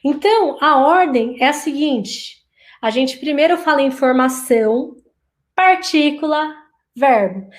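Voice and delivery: usual speaking voice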